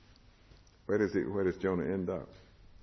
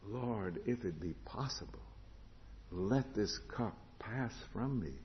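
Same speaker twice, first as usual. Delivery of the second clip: hushed prayer; 9 dB quieter than usual. Acted. Jesus praying in Gethsemane.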